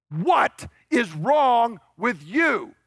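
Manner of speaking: What is wrong with you said high, loud and very slow, every word hammered; furious shout. Retold or imitated. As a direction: bellow What is wrong with you at the disappointing son, slowly. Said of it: "But what he is saying is".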